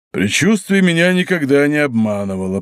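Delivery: usual speaking voice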